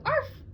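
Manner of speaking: sharp high yip; silly high arf. Acted. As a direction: bark like a small dog. Bark demonstrated.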